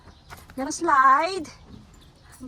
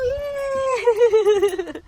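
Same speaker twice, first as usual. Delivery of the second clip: high, loud cheer with a laugh; laughing yay; 9 semitones higher, 6 dB louder than usual. Real, not acted. Child finishes slide. (malipayong singgit nga may katawa)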